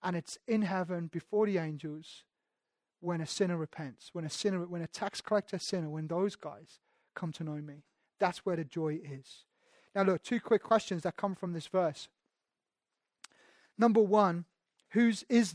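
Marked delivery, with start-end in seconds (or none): 0.0-9.4 preacher voicing God gently, soft and warm; gentle God. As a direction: lower and softer, tender correction. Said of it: "God says".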